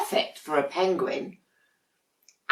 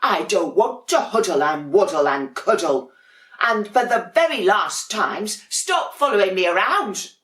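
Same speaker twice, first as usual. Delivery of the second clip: louder, exasperated complaint; fed-up penguin; 4 semitones higher, 6 dB louder than usual. Acted. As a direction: huff it out, fed up with the cuddling. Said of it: "said Dudley".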